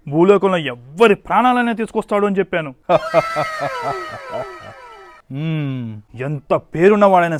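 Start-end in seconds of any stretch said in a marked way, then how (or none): 2.8-4.4 high mocking laugh.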